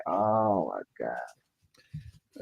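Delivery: very slow, low whispered oh my God; slow disbelieving whisper; whispered aside. Real, not acted. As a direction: breathe it out in disbelief. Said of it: "whispered exasperated aside".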